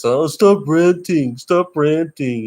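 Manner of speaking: mocking critics. Mimicking people who tell him to stop ranting, mock-nagging. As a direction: sneer it back like a scold. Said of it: "they always tell you".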